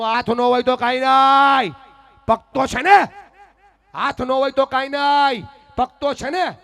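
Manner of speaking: loud, high, stretched-out motto; defiant declaration. Retold or imitated. Mother teaches armless daughter her answer, loud defiant declaration. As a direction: proclaim it at full voice, drawing out the words.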